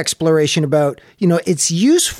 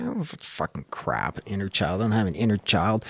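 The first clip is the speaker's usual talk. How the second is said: dismissive grumble; 7 semitones lower, 9 dB quieter than usual. Retold or imitated. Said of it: "It's like".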